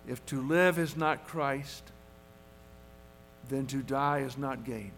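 put-on soft, hushed quote with a light Dutch accent; a hint of a Dutch accent, spoken softly; quoting in a Dutch accent. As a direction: recall the old Dutch preacher, lightly accented and quiet. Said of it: "in a thick Dutch accent".

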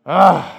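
loud grumbling ugh. Retold or imitated. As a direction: blurt the annoyed "Ugh" out hard. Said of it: "muttering things under your breath like".

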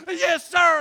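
loud, high imitation of a recruit; shouted recruit reply. Scared recruit answering his drill instructor, shouted. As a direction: sound off like a panicked recruit.

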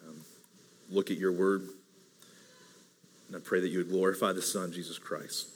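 soft, hushed prayer voice; low, gentle pastoral prayer; earnest prayer. Acted. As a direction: pray quietly over the congregation. Pastor prays before the sermon.

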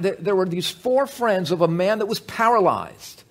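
ordinary delivery

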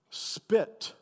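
spitting the word spit; spat out spit. Shown as a demonstration. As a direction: spit said with a hard spitting burst. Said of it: "My favorite onomatopoetic word".

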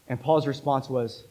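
ordinary talk, nothing marked

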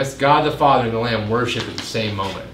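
ordinary delivery

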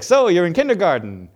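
loud, eager comic monster doing bad stand-up; Mike Wazowski. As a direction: ham it up, desperate to get a laugh. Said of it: "It's Billy Crystal, the voice of this monster".

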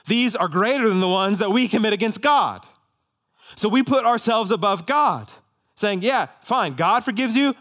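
high, loud pulpit proclamation; forceful preaching. Real, not acted. Preacher rebuking those who put themselves above God, forceful preaching.

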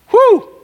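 high shouted woo of joy; preacher whooping with delight; joyful whoop. Real, not acted. Preacher whooping to the congregation, joyful shout.

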